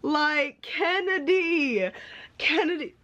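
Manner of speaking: high, drawn-out tearful call; tearful wail. Real, not acted. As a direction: whine the name out through tears. Crying reader addresses the author.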